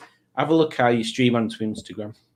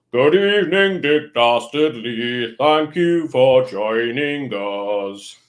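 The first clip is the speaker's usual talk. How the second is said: mock formal welcome; 4 dB louder, slower than usual. Formal mock greeting of viewer.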